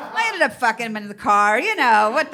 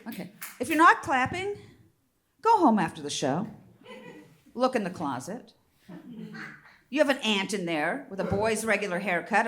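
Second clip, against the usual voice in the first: ordinary talk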